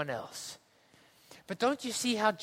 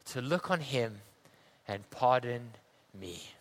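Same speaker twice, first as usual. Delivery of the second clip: solemn reading; 5 semitones lower than usual. Acted. Reads scripture and hymn solemnly.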